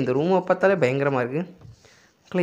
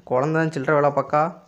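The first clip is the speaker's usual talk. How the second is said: ordinary delivery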